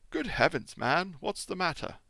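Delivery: doctor's startled cry at the door; alarmed cry. Acted. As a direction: blurt it out in alarm. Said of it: "cried the medical man".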